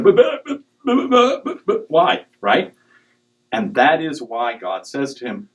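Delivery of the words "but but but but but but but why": high, laughing stammer on but. Retold or imitated. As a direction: stammer the buts, high and amused. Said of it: "Moses and [name] are both going".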